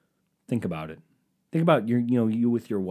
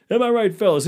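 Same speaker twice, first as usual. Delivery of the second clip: much higher, louder hammed-up "Am I right, fellas?"; louder catchphrase; 11 semitones higher, 8 dB louder than usual. Comic parodies a club comic, repeating the catchphrase louder. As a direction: push the catchphrase up high and loud.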